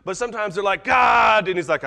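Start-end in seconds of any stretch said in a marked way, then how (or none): 0.8-1.5 loud, high drawn-out cry; yell at God. Acted. People crying out to God, exasperated yell. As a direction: wail the name upward at full voice. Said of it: "when people yell at God".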